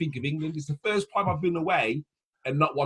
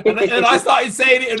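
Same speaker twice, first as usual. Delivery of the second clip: ordinary talk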